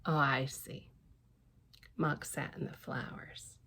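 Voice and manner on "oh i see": plain reading voice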